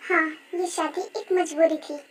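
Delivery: low, soft, subdued confession; reluctant admission. Acted. Yoongi yields to weeping Rose's demand, low and reluctant. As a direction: give in quietly, voice dropped.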